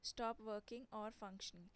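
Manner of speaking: slow, deliberate English gloss; slow dictation. Shown as a demonstration. Teacher to students, slow dictation. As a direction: spell it out slowly for learners. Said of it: "teacher gives English meaning".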